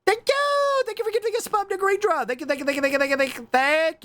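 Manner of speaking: high rapid squealed thank yous; excited high thanks. Real, not acted. Thanking the anonymous gifter, squealing high and excited.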